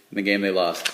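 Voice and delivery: ordinary delivery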